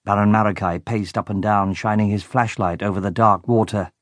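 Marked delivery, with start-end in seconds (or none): none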